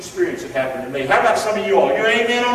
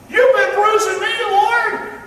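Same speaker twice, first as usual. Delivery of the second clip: hurt son; 13 semitones higher, 5 dB louder, slower than usual. Acted. Son complains to God.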